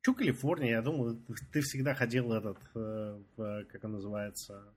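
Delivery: ordinary delivery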